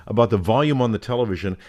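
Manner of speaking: ordinary talk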